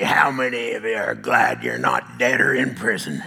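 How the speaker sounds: deep old cowboy preacher impression; Brent. Cowboy friend addressing the crusade crowd, low imitated cowboy voice. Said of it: "He talks like this".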